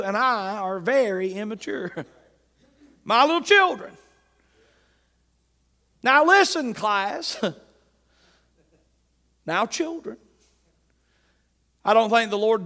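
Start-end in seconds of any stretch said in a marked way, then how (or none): 3.0-10.2 louder, higher, teasing preacher; mock schoolteacher address. Real, not acted. Preacher to congregation, playful teacher tone. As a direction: call the flock like a class.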